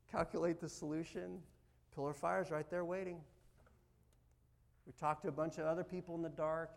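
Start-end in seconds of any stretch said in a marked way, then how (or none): none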